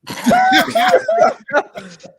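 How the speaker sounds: high shrieking laugh; loud burst of laughter. Real, not acted. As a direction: cackle loudly at the joke. Huge laugh at beard joke.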